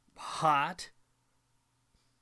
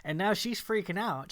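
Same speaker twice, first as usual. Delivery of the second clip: usual speaking voice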